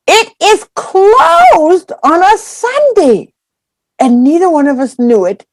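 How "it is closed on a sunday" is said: high, slow, incredulous cry; can't believe it's shut; outraged disbelief. Retold or imitated. Disbelief at the closed hot dog stand.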